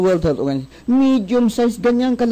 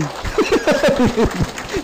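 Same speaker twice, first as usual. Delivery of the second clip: laugh; 7 semitones higher than usual. Real, not acted. Laughing at gin joke.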